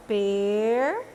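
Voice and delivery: PER held long on its own; isolated syllable. Shown as a demonstration. Teacher shows pupils one syllable, drawn-out per. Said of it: "é uma sílaba".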